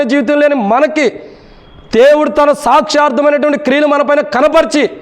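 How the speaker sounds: high, sustained sermon delivery; impassioned preaching. Real, not acted. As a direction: preach it in a high, driving chant-like voice. Preaches at heightened pitch. (ఉద్వేగంతో ఎత్తైన స్వరంలో ప్రసంగం)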